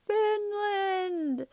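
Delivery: high, drawn-out cartoon scream. SpongeBob hit by Doodlebob with a bowling pin, high scream. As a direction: wail the word in a very high voice. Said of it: "and he screams".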